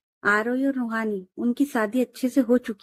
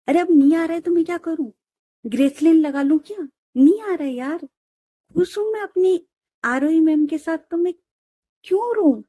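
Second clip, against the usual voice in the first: higher, quick, exasperated rant; exasperated protest; 6 semitones higher than usual. Acted. Bride protests to teasing friends that the tears won't come, exasperated. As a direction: brush them off, laughing at the fuss.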